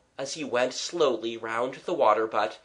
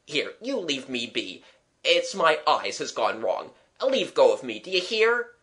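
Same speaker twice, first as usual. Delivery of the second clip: agitated gentleman friend; 3 semitones higher than usual. Acted. Gentleman friend demanding they leave go of him, agitated.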